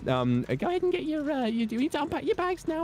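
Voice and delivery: high-pitched airport worker giving orders; British airport staff. Acted. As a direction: chivvy travellers along in a high put-on voice. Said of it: "not famously hospitable, but still like".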